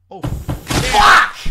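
sudden yell at a crash; startled yell. Real, not acted.

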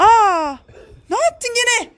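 high, startled exclamation; shocked alarm. Real, not acted. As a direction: cry out in sudden dismay. Child throws something out again.

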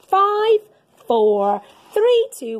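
excited loud countdown. Acted. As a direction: count down to blast off with rising thrill. Rocket launch countdown.

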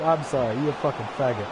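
ordinary talk

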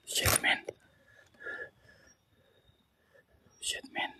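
frightened whispered curse; scared whisper. Real, not acted. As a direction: breathe it out, scared.

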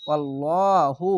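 মোটা করে ওয়াল্লাহু; heavy Quran recitation. Shown as a demonstration. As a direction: recite ওয়াল্লাহু thick and drawn out. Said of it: "এখানেও মোটা করে পড়লাম".

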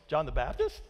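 BAPTIST rising sharply at the end; questioning inflection. Shown as a demonstration. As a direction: turn BAPTIST into an unsure question. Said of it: "the inflection in your voice".

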